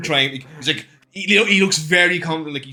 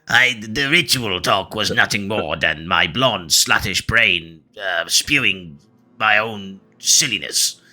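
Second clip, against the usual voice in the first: low, very slow dictation; ponderous character voice prompting an apology; talking so slow; 6 semitones lower, slower than usual. Acted. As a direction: drag each phrase out in a low voice. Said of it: "Why is he talking so slow?".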